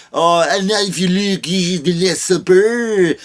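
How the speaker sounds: slow, lightly put-on guide voice; a smug guide pointing out the art; mock tour guide. Mocking a French gallery guide.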